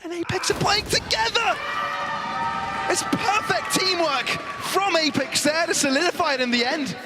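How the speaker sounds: high, urgent caster call; excited play-by-play. Acted. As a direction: build the hype as it happens. Live play-by-play.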